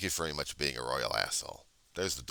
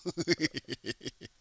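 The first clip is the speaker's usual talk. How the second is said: laugh; 10 semitones higher than usual. Real, not acted. Reacting to his own joke.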